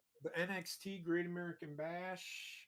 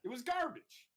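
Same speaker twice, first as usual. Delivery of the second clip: emphatic outburst; 10 semitones higher, faster than usual. Real, not acted. Insists everything was bad.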